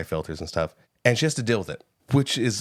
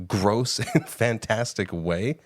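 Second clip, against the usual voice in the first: ordinary talk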